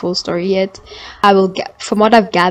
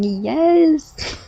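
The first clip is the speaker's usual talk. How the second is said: high, thrilled yes; gleeful yes; 8 semitones higher than usual. Retold or imitated. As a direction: squeal your approval. Reacting to the sex scene.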